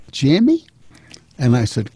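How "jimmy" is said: high, surprised granny call; grandmother voice. Retold or imitated. Grandma recognizing her bearded grandson, high surprised call. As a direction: peer through the door crack, high and unsure. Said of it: "and she went".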